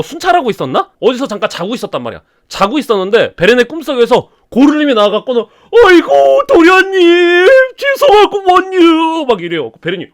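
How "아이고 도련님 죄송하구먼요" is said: much higher, slow, rustic dialect wail; dead servant begging his young master's forgiveness; wailing ghost servant. Acted. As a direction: lament as a guilty ghost. Gorlim's ghost apologizes.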